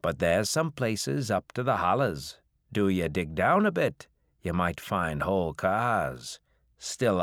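put-on a crusty old farmer spinning a tale; old backwoods farmer. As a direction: hill farmer warning off the revenuers. Old farmer voiced at length.

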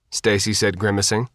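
plain reading voice

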